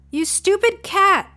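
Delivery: high, loud exasperated snap; high frustrated outburst; angry at the cat. Acted.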